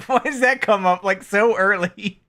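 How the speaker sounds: asked through laughter, loud; laughing. Real, not acted. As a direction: laugh while asking. Laughing at video.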